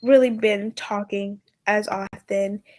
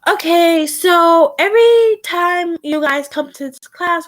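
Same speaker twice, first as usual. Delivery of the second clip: higher, louder hint of a teacher; teacher addressing class; 6 semitones higher, 4 dB louder than usual. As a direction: lightly act a teacher calling the class together. Game master voices teacher addressing class.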